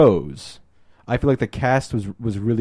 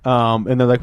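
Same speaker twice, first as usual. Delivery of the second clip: usual speaking voice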